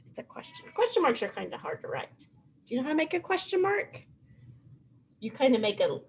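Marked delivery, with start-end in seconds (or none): 0.1-0.7 whispered. Shown as a demonstration. Teacher whispering It's a question to first graders. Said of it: "Should I say it".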